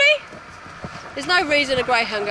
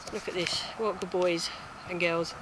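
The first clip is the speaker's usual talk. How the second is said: soft praise; 6 semitones lower, 11 dB quieter than usual. Real, not acted. Soothing dogs to hold pose.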